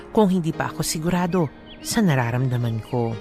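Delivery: ordinary delivery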